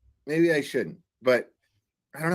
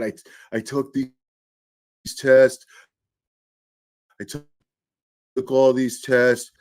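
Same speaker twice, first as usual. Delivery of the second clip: imitating Trump; 5 dB louder than usual. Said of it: "Trump starts saying".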